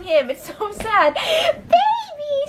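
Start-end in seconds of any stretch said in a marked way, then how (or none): 1.1-2.5 much higher squealed call with a laugh; silly pet voice. Retold or imitated. Calling to her excited pet, high squealed voice. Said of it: "when I do that voice".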